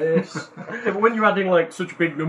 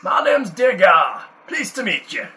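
Scottish dwarf voice; 6 semitones higher, 7 dB louder than usual. Acted. Dwarf introduces himself to rescuer.

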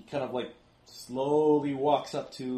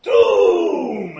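lower, very loud, long bellow; another creature's cry; 7 semitones lower, 16 dB louder than usual. Acted. Another creature calls from the other side, ominous bellow. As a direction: bellow the omen from deep down. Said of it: "you hear it again on the other side".

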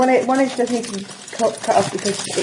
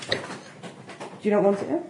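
whispering; 2 semitones lower, 8 dB quieter, slower than usual. Real, not acted. Whispered aside.

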